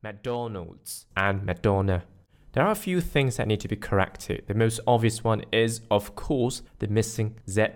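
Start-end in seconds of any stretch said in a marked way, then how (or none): 0.0-2.0 "McDonald's and Madonna" slowly side by side; slow, careful contrast of the two words; contrasting pronunciations. Shown as a demonstration. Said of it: "listen to the differences".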